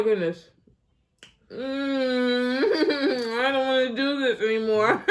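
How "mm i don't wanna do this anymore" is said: laughing. Real, not acted. Laughing through the spicy food.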